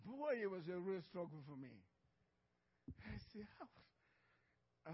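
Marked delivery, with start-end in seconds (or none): 3.6-4.9 breathy whispered question. Retold or imitated. Preacher whispering his question to God in the dream. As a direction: barely breathe the word.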